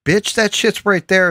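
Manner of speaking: high, loud mock yell of a rude customer. Retelling the New York guy yelling at the clerk, high mock yell. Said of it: "he was like".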